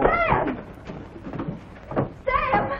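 high, eager call. Acted. Young woman hails Sam as he arrives, eager high call. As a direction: send the name out in a high, excited voice.

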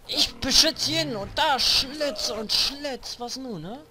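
high, slow, loud taunt; taunting shout. Real, not acted. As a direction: yell a taunt. Taunting while fighting.

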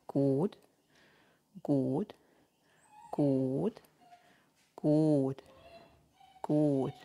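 low, soft good with a rising lilt; calm steady encouragement; soft low praise. Real, not acted. Praising dog during stay.

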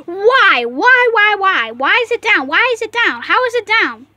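loud, high, swooping whys; hammed-up exasperated shouting; exasperated yelling. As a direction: demand it over and over at a yell. Customer's outburst over broken machine.

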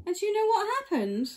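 high, fast, eager question; excited storyteller. A narrator's reading. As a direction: build suspense for the ending. Teasing the ending.

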